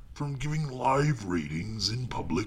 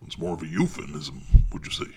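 ordinary talk

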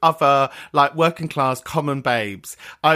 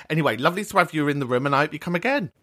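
ordinary talk

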